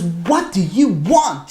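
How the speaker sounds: slow, heavily spaced exasperated question; frustrated demand. Retold or imitated. Daughter finally opening the door to the knocker, slow frustration. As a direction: act out demanding an answer, dragging each word. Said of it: "She's so frustrated".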